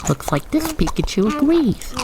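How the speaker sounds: usual speaking voice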